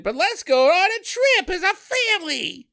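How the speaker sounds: put-on high, chirpy old lady voice; mock sweet old lady. Old matriarch cheerfully announcing a family trip, high and sweet. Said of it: "the old lady's like".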